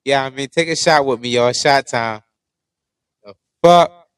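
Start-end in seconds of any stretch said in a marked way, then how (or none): 3.2-4.1 sudden loud startled cry. Real, not acted. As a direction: jump at the noise. Startled by hitting the mic.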